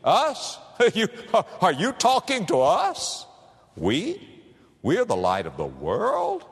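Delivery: high, astonished "Who, me? Us?"; incredulous villagers. Retold or imitated. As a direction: play disbelieving bumpkins, high and slow. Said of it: "I can just imagine them saying".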